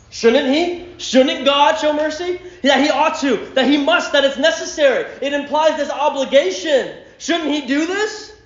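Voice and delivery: high, loud challenging questions; pressing preaching. Acted. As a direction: push the point hard.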